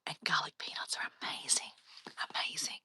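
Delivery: hushed voiceless relish; dreamy whisper. Real, not acted. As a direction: let it fade into a whisper of longing. Dreamy praise of garlic peanuts.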